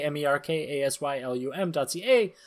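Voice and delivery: normal talking voice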